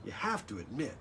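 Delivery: lively, sing-song storybook read; bouncy picture-book reading voice; reading to children. Acted. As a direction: bring the rhymes alive for the kids. Reads the rhymed book text.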